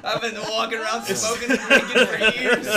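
laughing loudly through the words. Real, not acted. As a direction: laugh while confessing. Joking self-mockery, laughing.

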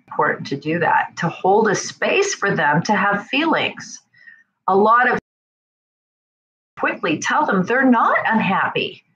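normal talking voice

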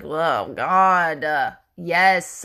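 low, drawn-out weary groan; exasperated. Retold or imitated. Wife fed up with husband's constant emails, exasperated. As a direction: groan it out, imitating fed-up compliance. Acting out irritation at his emails.

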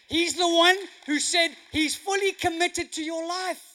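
full-force preaching. Real, not acted. Preacher declaring God's promise to the congregation, forceful and loud. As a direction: drive every phrase home to the crowd.

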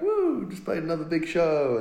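high, loud, showy whoop; mock Instagram cheer. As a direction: brag like a perfect social media post. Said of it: "kind of present this, like, in-, Instagram perfect".

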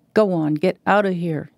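ordinary delivery, nothing marked

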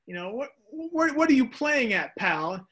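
mock-indignant what are you playing at; exasperated Abner. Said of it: "Abner's probably going".

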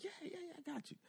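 meek agreement. Retold or imitated. Homie's meek agreement, acted.

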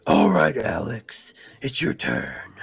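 very low put-on voice; hero voice. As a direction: announce it loudly, then sink very low. Host keeps up hero voice.

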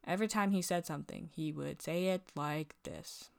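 a flat, low, quiet mock of the tech; imitating the ultrasound tech. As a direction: drop into his odd droning way of talking. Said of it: "he kept talking like this".

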